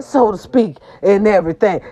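usual speaking voice

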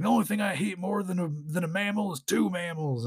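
put-on cartoon cowboy voice; cowboy T-Rex. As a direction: play a quick-draw cowboy dinosaur. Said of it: "it looks like this cowboy".

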